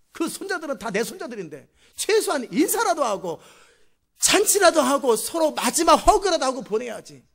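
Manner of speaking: angry scolding. Acted. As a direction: berate a runaway relative, voice climbing loud and high. 라반이 야곱을 꾸짖는 장면. (언성을 높여 화내며 꾸짖기)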